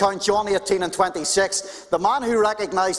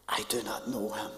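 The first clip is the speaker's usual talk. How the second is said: hushed, scared whisper of a denial; 7 semitones lower, 13 dB quieter than usual. Acted. Peter whispering his denial to the servant girl. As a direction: breathe the words out in fear.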